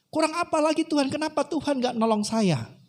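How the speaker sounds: high, aggrieved acted complaint; protesting. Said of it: "protes gitu kan". (protes menuntut jawaban)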